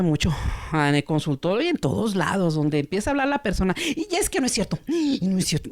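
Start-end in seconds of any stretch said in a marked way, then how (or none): 3.9-5.7 interrupting. Retold or imitated. Person interrupting in consultation.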